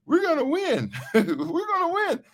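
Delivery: high, excited squeal of hope; giddy. Retold or imitated. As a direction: squeal it like victory is certain. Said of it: "he was like".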